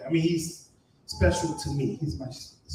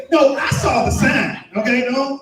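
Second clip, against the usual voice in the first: mock shout; 8 semitones higher, 6 dB louder than usual. Mock shout at audience member.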